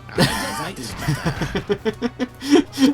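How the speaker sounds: bursting into laughter; a real amused laugh; hearty laugh. Real, not acted. Player laughs at game moment.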